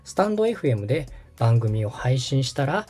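ordinary talk, nothing marked

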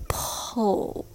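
"pull" whispered, short, with no A; reduced syllable. Shown as a demonstration. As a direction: breathe out a quick "pull", skipping the A. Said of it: "Just ignore the letter A in between".

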